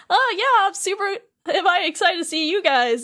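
high-pitched imitation of an eager kid; super excited. Sora greets his running friends, imitated super excitement. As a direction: act out eager excitement, voice jumping up. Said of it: "Sora's just super excited".

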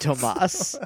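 Tomas with a suave rising lilt; a hint of a smooth international playboy; sexy playboy voice. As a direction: purr the name like a playboy. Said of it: "here's a sexy international playboy".

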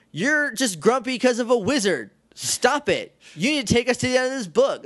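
high-pitched impatient order; bossy, assertive heroine. Retold or imitated. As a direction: imitate a heroine bossing a grump around. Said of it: "compare that Kim to the Kim".

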